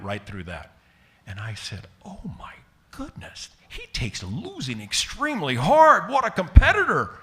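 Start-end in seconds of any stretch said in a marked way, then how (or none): 2.0-3.5 whispered, much higher; awed whisper. Retold or imitated. Mock awe at manager's tantrum. 3.7-7.2 much higher and louder, building; mock admiring exclamation. Ironic praise of the tantrum.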